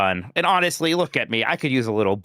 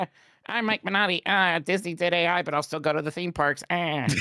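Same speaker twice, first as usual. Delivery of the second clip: higher, hammed-up self-parody; Mike voice; 6 semitones higher than usual. Mike showing off his impression of himself, self-mocking. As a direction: exaggerate your own voice as a mock introduction. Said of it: "even I do the Mike voice".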